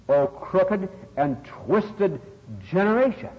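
ringing, deliberate pulpit quote; scriptural rebuke. Retold or imitated. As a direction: draw out each word of the quoted rebuke. Preacher repeats Jesus' rebuke.

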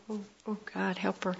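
hushed prayer. Retold or imitated. Teacher praying for the student as she leaves, hushed plea. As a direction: pray it under your breath.

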